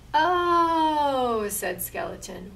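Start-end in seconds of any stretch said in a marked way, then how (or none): 0.1-1.5 high, loud drawn-out oh; surprised oh. Acted. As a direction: realize it with a long oh. Skeleton realizes misunderstanding.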